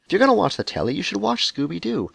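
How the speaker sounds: ordinary talk, nothing marked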